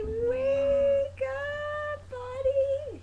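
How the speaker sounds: high, loud wake-up call; talking to a baby. Pretend.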